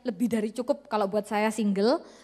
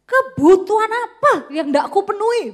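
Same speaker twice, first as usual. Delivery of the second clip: loud, high, scoffing retort; indignant husband; 8 semitones higher, 7 dB louder than usual. Acted. Husband retorting to his unfaithful wife, indignant. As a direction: throw the question back, offended.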